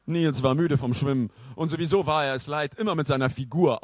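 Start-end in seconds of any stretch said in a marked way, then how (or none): none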